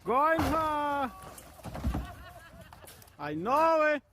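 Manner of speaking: usual speaking voice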